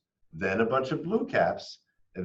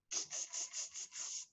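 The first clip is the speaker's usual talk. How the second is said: soft unvoiced tss tss; whispered monkey chatter; 17 dB quieter than usual. A narrator's reading. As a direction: click like cheeky monkeys. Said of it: "and said".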